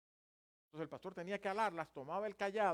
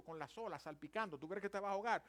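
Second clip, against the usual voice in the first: ordinary talk, nothing marked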